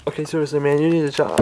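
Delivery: ordinary delivery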